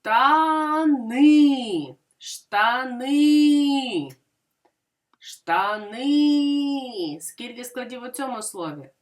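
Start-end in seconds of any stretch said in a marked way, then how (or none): 0.1-7.2 Штани with a long held НИ; stress on last syllable. Shown as a demonstration. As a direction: stretch Шта-НИИ syllable by syllable, leaning on НИ. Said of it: "І визначимо наголос".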